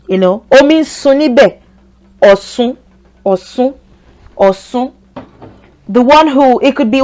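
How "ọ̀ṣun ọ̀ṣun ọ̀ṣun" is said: Ọ̀ṣun said with a plain s, rising at the end; Ọ̀ṣun with an s, not sh; pronounced with S. Shown as a demonstration. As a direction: say Ọ̀ṣun as "aw-soon". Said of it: "they use C instead".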